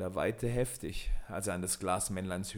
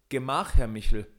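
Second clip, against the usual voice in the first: a firm, louder call; 2 semitones higher, 7 dB louder than usual. A narrator's reading. Peter holds off the giant with a cross, calling out. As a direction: call out to halt him. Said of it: "rief Peter".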